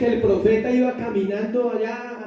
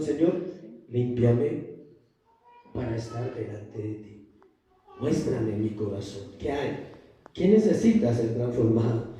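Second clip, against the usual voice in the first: humble prayer; 8 semitones lower than usual. Acted.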